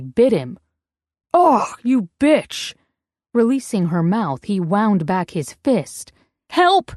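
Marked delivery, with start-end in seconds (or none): 1.3-2.7 higher, louder, dragged-out curse; angry outburst. A narrator's reading. Ex bitten on the hand, cursing her in rage. 6.5-6.9 much higher, louder cry for help; shriek. A narrator's reading. Woman shrieking for help as her ex swings. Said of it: "she shrieked".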